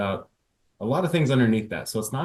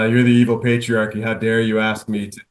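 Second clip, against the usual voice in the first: louder, hammed-up outrage at the husband; mock outraged wife; 7 dB louder than usual. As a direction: play the offended wife, over the top. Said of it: "so she says".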